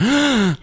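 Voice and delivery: high, drawn-in mock gasp; imitated gasp. Rachel sees the stranger by lighter flash, imitated gasp. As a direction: gasp in as if amazed. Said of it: "like gasping".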